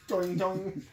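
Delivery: Mario jump sound. Retold or imitated.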